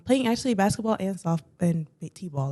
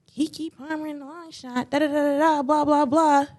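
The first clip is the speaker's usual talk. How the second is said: mock nagging; 8 semitones higher than usual. Daughter mimicking dad's movie argument, mock nagging. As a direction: mimic a tired argument, pitched up.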